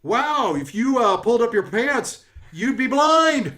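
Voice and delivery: high, loud insult-comic voice; brash heckling jokester. Acted. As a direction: play a boisterous greeter roasting the guests. Jokester figure roasts a player.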